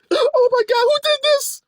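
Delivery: high, loud horrified gasp; be the appalled shopper; scandalized shock. Retold or imitated. Parent seeing the album in Target, high shocked exclamation.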